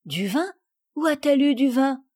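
surprised questions rising in pitch; a child taken aback, lightly acted; astonished. A narrator's reading. Sophie hears of wine on the dress, astonished. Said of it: "Sophie étonnée".

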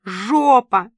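loud, drawn-out yell of abuse; громовой вопль. A narrator's reading. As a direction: bellow it as an angry insult through an earpiece. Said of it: "громовой вопль Ирки". (громкий, протяжный вопль)

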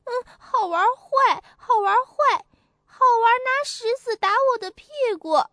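high, loud whiny tattling; upset little monkey. A narrator's reading. Little monkey complaining to the old monkey, high and whiny. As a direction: tattle tearfully to grandpa. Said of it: "更生气了，跑去告诉老猴子".

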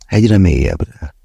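plain reading voice

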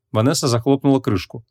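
ordinary delivery

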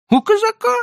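put-on high, pleading old merchant. A narrator's reading. Leiba answers.

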